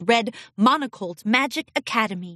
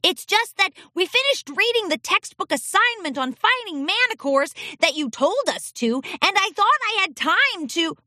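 put-on higher child voice, explaining; young colt voice; 9 semitones higher than usual. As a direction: justify yourself like a kid.

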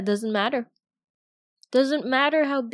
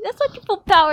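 higher, much louder, squeezed protest; strained loud protest; 9 semitones higher, 10 dB louder, faster than usual. Acted. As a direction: shout it out from under the weight. Strained protest while pinned.

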